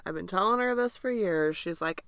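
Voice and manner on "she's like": usual speaking voice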